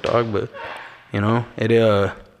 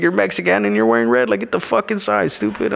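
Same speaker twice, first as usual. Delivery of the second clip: imitating sister-in-law; 10 semitones higher than usual. Sister-in-law rushing out at him over red shoes, higher mock scold.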